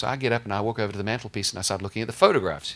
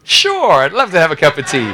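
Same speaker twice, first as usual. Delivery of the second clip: eager yes; 8 semitones higher, 16 dB louder than usual. Retold or imitated. Eagerly accepting tea invitation.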